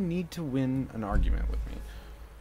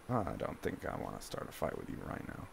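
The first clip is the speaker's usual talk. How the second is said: hushed, much lower and softer; calm de-escalation; 9 semitones lower, 12 dB quieter than usual. Acted. Son showing mom how to avoid a fight, calm and hushed. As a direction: back off gently, almost under the breath.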